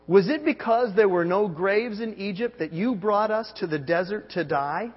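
loud, high and slow, building despair; preacher hams the people's panicked outcry; anguished complaint. Acted. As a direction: cry out at Moses as the terrified Israelites. Israelites wail at Moses, scripture voiced.

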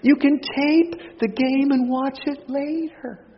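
high, slow, teasing put-on wife voice; high, slow mock-patient wife; voicing his wife. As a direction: play the wife scolding him sweetly. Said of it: "And my wife said".

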